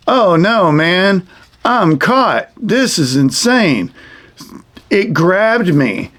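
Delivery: high-pitched mock dismay, slowing towards the end; dismayed snake puppet. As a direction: play a caught cartoon snake wailing in pretend despair. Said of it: "and the snake is like".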